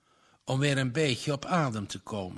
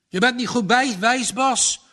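higher, louder, indignant father; pressing indignation; 8 semitones higher, 6 dB louder than usual. A narrator's reading. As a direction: scold the son with rising outrage. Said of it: "met klemmende verontwaardiging". (vader berispt zoon met klemmende verontwaardiging)

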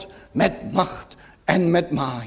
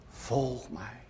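hushed, far-off call of Christ; soft call; 6 semitones lower, 15 dB quieter than usual. Retold or imitated. Preacher voicing Christ's call to the hesitant sinner, hushed and soft. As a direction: let it fall quiet like a distant voice. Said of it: "En hij roept mij".

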